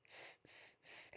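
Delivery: "S, s" as a soft voiceless hiss; voiceless whispered "s" hiss; hissed s sound. Shown as a demonstration. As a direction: hiss the "s" quietly like a snake. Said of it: "just move him in the middle".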